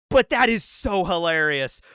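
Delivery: ordinary delivery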